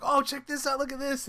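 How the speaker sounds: high, eager show-off; excited. Retold or imitated. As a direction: pitch it up with excitement. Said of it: "I'm like".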